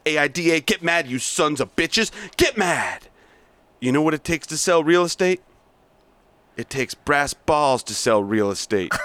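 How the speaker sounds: hard-driving sales boss berating staff; bully the salesmen with contempt; reading Blake's tirade, pushy and mocking; aggressive sales boss. Plays Blake monologue.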